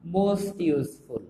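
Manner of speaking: very slow, every syllable stretched; a teacher dictating "most useful" at a crawl; drawn-out model phrase. Shown as a demonstration. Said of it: "এর superlative হবে".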